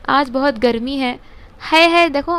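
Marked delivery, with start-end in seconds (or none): 1.7-2.4 है said high and loud, twice; stressing है. Shown as a demonstration. Teacher points the class to the word है, stressing it. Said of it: "जहाँ पे आता है".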